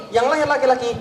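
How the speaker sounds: normal talking voice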